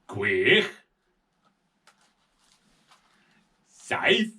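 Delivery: Un, dau, tri said slowly in Welsh, one by one; counting in Welsh. Shown as a demonstration. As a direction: count Un to deg in Welsh for a child. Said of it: "This is in Welsh".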